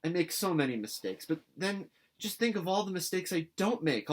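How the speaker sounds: put-on high, bright girlish pleading; a breathless excited girl begging; an eager girl pleading with her guardian; eager young Anne. A narrator's reading. Anne earnest.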